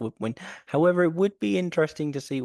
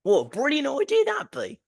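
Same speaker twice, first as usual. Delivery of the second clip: mock posh gush; 14 semitones higher, 4 dB louder than usual. Mock posh exclamation, far higher.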